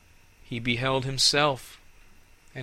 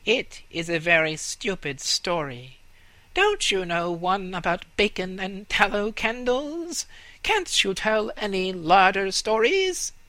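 high scornful rat voice, louder; 8 semitones higher, 3 dB louder than usual. Acted. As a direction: sneer at the story. Rats complain.

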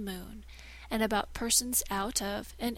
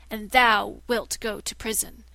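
gloating threat; 8 dB louder than usual. Acted. Shadow sentences him.

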